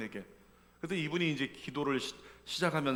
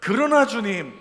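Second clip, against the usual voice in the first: very loud, high proclamation; shouted confession; 6 semitones higher, 14 dB louder, slower than usual. Shown as a demonstration. Preacher modelling the confession, loud shout. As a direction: declare it with full force. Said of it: "이렇게 고백하는 겁니다".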